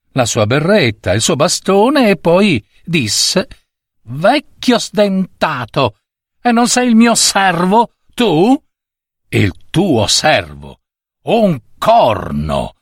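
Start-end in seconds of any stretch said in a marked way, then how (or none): 4.0-8.6 put-on high, slow, suspicious child's challenge; acted high-voiced young bully; bossy little boy. A narrator's reading. Boy challenges the disguised servant. 9.3-12.7 slow, scornful denial in a put-on voice; mock-offended stranger, slow and emphatic; disguised old servant. A narrator's reading. Disguised servant indignantly denies who he is.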